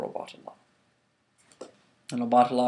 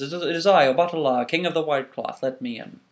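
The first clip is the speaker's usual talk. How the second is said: regal declaration; 3 semitones higher, 7 dB louder than usual. Acted. Obatala announces himself.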